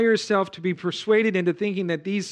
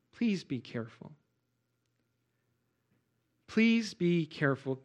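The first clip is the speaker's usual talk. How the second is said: earnest plea; 5 dB quieter than usual. Real, not acted. Pastor urging the church family softly and sincerely. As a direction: plead gently with the church.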